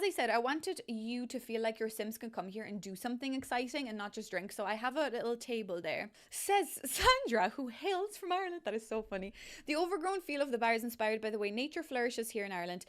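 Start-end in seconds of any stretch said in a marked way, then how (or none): 6.3-8.6 high, slow, hammed-up announcer voice; playful slow fanfare voice; mock grand announcer. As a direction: announce yourself like a grand herald, tongue in cheek. Mock announcer reading her own quote.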